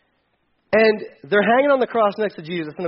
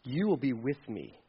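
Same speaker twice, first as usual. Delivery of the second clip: much softer, lower, gentle promise; tender promise; 7 semitones lower, 11 dB quieter than usual. Retold or imitated. Jesus promising the dying thief paradise, tender. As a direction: speak it softly, full of mercy. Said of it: "Jesus says".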